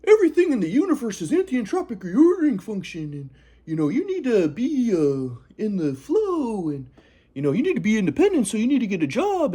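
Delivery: a hint of a preachy grandfather; grandpa lecturing. Grandpa lecturing grandson about the universe, lightly voiced. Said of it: "my grandpa was like".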